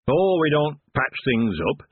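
lofty. Acted. Dwarf turning down a patch job for Tiffany, lofty. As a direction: look down on a mere repair job. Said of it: "said the first dwarf loftily".

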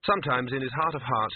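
ordinary delivery

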